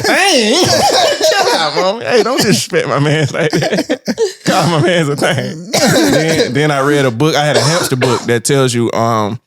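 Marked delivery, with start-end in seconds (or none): 0.0-5.7 high, laughing mock protest; indignant kid. Kid defending his dead hamster to mama, high and laughing. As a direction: play the offended little boy.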